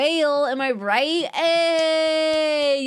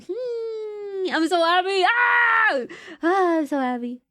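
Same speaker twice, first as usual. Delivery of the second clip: overjoyed; 7 semitones higher, slower than usual. Real, not acted. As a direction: squeal with real joy, almost crying. Overjoyed about landing the job.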